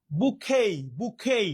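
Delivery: "বুকেই" with silent t, ending -khei; original pronunciation. Shown as a demonstration. Teacher giving the real pronunciation, "বুকেই" with no final t. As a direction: say "বুকেই" as bu-khei, falling on each take. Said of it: "এর মূল বা আসল pronunciation হচ্ছে".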